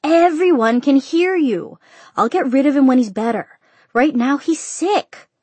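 high, loud, drawn-out yelling; slow, high, fed-up daughter's outburst; exasperated shout. A narrator's reading. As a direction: shout back at your father, stretching each phrase. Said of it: "she finally shouted".

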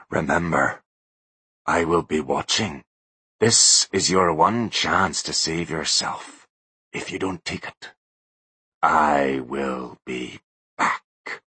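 very slow, low final warning; slow ghostly threat. A narrator's reading. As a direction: leave him trembling. Ghostly Butler voice.

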